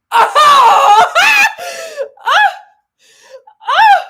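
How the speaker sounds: high, loud laughing squeal; delighted shriek. Real, not acted. Thrilled by the new scarf, shrieking with laughter.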